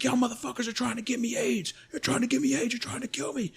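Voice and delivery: very high, imitated hysterical screech; losing his shit. Panicking recruit to the medical staff, high hysterical accusations. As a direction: mimic a panicked man screaming accusations. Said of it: "he fucking loses his shit".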